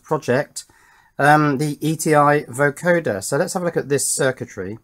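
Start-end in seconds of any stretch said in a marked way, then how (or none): none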